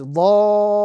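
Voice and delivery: "dhalina" with the vowel held long, then a doubled lam; tajweed reading of "dhalina", prolonged madd; Quranic recitation. Shown as a demonstration. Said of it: "the correct pronunciation of this particular example is".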